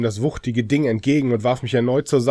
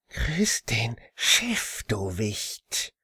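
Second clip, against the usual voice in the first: demon voice; 4 dB quieter, much slower than usual. Acted. Demon about to slit throat.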